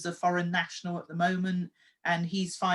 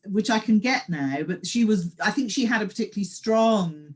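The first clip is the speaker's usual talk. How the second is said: ordinary talk, nothing marked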